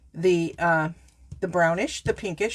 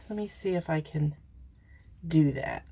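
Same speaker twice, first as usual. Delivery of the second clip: whisper; 4 semitones lower, 6 dB quieter than usual. Real, not acted. Quiet aside to herself.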